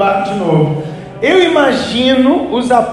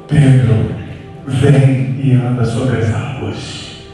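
much lower, slow, calm divine voice; deep voice of Jesus; 9 semitones lower, much slower than usual. Retold or imitated. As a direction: answer low and unhurried as God. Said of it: "a voz de Jesus é grossa". (voz grossa e lenta de Jesus)

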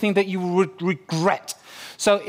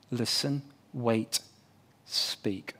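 each step softer, ending in a whisper; fading to a whisper; 6 semitones lower, 10 dB quieter, much slower than usual. Shown as a demonstration. Pastor teaching the listening steps, fading to a whisper. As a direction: let it die away to a breath on speak. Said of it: "It will really help you".

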